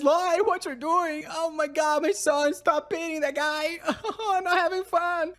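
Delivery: father gleefully pretending to scold his son; smiling baron. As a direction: protest while clearly delighted. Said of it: "The Baron smiling as he says".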